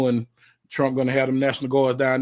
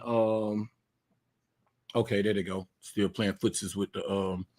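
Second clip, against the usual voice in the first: normal talking voice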